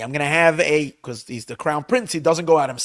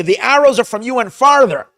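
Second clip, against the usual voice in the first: higher, louder call to the lad; 7 semitones higher, 6 dB louder than usual. Retold or imitated. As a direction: signal the boy from afar. Jonathan's signal call to lad.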